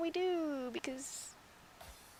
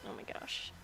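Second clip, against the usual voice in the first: whispered dismay; 11 dB quieter than usual. Real, not acted. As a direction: breathe it out, annoyed. Drawing mistake.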